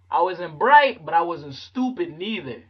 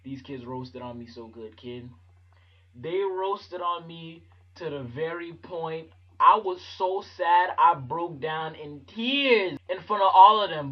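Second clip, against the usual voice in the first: ordinary talk, nothing marked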